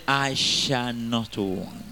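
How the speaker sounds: very slow, drawn-out declaration. Shown as a demonstration. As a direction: stretch every word out slowly. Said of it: "I say".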